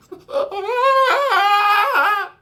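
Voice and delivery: long high, loud mock wail; acted scream. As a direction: scream like a panicked kid running away. Child screams while running away.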